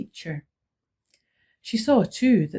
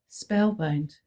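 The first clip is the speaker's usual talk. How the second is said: usual speaking voice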